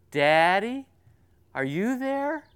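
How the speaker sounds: put-on a child checking that mommy is near; little girl's voice. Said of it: "this little voice".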